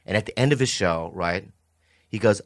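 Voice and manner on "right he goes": ordinary delivery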